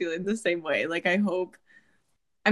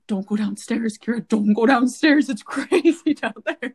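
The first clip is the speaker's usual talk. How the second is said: rising, louder warning that breaks into a laugh; playful alarm to the roommate, laughing; mock warning, laughing; 2 semitones higher, 5 dB louder than usual. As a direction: warn her off, cracking up. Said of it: "I go in there and I'm like".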